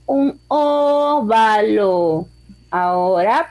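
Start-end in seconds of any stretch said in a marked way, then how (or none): 0.0-2.3 un óvalo stretched out slowly; slow, syllable by syllable. Shown as a demonstration. Teacher dictating the shape name to pupils, slow. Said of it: "vamos a hacer un óvalo".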